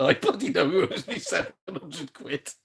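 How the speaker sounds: laughing through the words. Real, not acted. Teasing his wife about the money, laughing. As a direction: break into a laugh while insisting.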